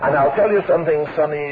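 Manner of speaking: put-on low-pitched, unhurried reply of an old preacher; low, dry quoted preacher; Nicholson. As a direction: drop the voice well down, matter-of-fact. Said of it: "He said".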